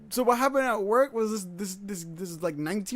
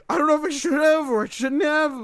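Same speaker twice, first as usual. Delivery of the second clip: panicky quote; 8 semitones higher, 6 dB louder than usual. Retold or imitated. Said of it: "He's like".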